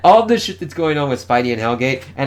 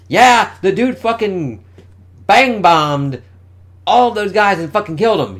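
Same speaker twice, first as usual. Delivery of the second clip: excited; 8 semitones higher, 7 dB louder than usual. Real, not acted. Reader hyped about comic violence.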